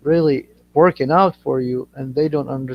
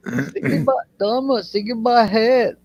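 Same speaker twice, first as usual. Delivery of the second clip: higher, teasing mock Aussie voice; Australian accent; 5 semitones higher than usual. Teasing Thomas with slang, high mock Australian accent. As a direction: tease in put-on Aussie. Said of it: "I like accents, they're funny".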